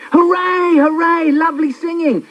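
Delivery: mock cheering. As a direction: cheer like parents humouring a toddler. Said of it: "and clap our hands".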